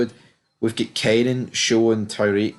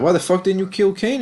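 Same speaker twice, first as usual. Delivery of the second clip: higher, indignant mock outburst; mock angry demand; 9 semitones higher than usual. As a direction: throw the question at him in mock outrage. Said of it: "they're like".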